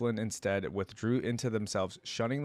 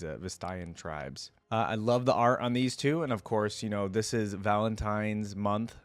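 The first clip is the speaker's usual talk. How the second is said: normal talking voice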